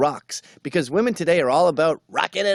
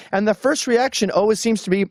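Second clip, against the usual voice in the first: usual speaking voice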